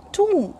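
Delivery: "tomb" rhyming with room; tomb as "toom". Shown as a demonstration. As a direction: say "tomb" with a long OO and no B. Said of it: "not tom".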